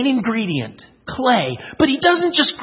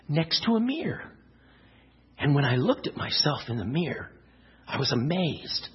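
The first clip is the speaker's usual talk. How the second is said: low, soft storytelling after the storm; subdued, lower-pitched narrator as the relieved clay; hushed story narration; 7 semitones lower, 5 dB quieter, faster than usual. Acted. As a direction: tell it quietly, building to wonder.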